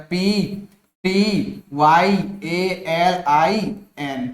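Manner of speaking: slow, letter-by-letter spelling. Shown as a demonstration. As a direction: spell it out slowly, each letter on its own. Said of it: "spelling उसकी मैं आपको बता दूं". (एक-एक अक्षर धीरे-धीरे बोलकर स्पेलिंग)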